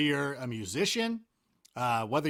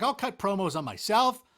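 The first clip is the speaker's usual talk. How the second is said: normal talking voice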